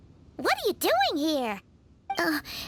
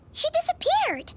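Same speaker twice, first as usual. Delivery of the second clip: higher, startled cry of surprise; a shocked exclamation, pitched up; surprised exclamation; 8 semitones higher than usual. Acted. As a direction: gasp it out as she vanishes. Stranger vanishes suddenly.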